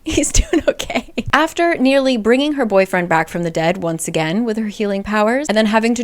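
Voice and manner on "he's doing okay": a giggling, higher repeat; laughing reassurance. Real, not acted. Vlogger laughing while reassuring fans about Simon.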